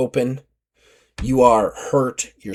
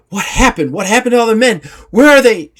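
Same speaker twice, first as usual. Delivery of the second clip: alarmed first mate; 8 semitones higher, 5 dB louder, faster than usual. Acted. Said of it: "She goes".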